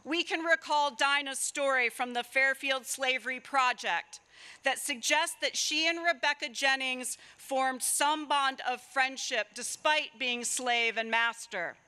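normal talking voice

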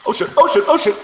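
sudden panicked high-pitched cry; real alarm, enemy spotted; startled yelp. Real, not acted. Suddenly spots enemy player.

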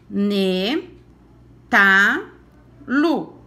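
"Ne, ta, lu" as separate, punched syllables; reading syllables one by one. Shown as a demonstration. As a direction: read each syllable card out with a beat. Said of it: "nós vamos ler as sílabas".

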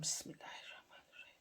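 a soft whispered bismillah under the breath; whispered blessing. Real, not acted. Cook whispering a blessing over the pan, hushed.

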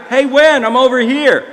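high, loud friendly hail; calling out. Retold or imitated. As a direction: call to someone across a busy street. Driver calls out to passenger.

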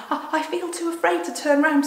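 high, loud fearful stammer; acted fright, pitched right up; too scared to look; frightened girl. Frightened Susan acted in story.

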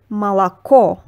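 молоко with only the last O stressed and clear, first two reduced toward A; Russian word. Shown as a demonstration. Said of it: "So we say".

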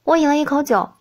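usual speaking voice

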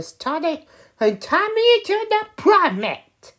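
very high, loud excited villain build; dramatic reveal. Acted. Kid revealing the villain's transformation, high loud dramatic voice. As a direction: shout it like the big transformation moment. Transformation climax in toy story.

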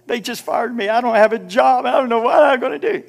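high, fretful imitation of someone just laid off; mocking a despairing churchgoer's fear; mock panic. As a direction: whine it like the world has ended. Imitating panicked fired worker.

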